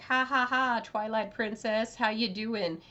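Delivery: loud, mock ha-ha-ha, then a breezy greeting; joking Midna. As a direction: play a teasing joker greeting a princess. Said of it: "Midna makes a joke like".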